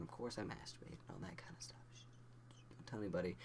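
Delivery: hushed, whispered aside; confidential whisper. Real, not acted. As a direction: whisper it like a secret to the viewers.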